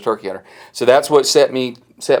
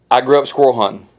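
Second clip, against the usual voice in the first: ordinary talk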